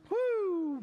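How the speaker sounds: a high, sudden woo; high whoop. Real, not acted. Preacher reacting to the hard verse, high woo. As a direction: yelp it up high.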